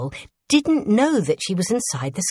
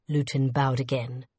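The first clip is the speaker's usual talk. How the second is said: ordinary delivery, nothing marked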